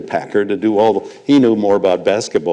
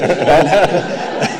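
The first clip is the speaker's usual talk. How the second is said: high chuckle; 7 semitones higher than usual. Real, not acted. Laughs at his own story.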